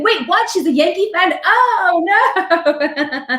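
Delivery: high-pitched mock outrage; shocked nasty commenter. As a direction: act out scandalized disbelief, breaking into a laugh at the end. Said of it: "get these nasty comments".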